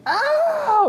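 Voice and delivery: very high-pitched mock shriek of pain; imitated scream. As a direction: yelp like a poked little girl. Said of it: "Hannah just screams".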